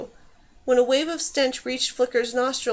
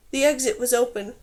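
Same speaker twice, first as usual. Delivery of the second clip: usual speaking voice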